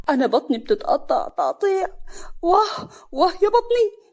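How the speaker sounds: high moaning cry of bellyache; pained wail. A narrator's reading. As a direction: groan and wail, stretching the cries. Stomach cramps after eating cake.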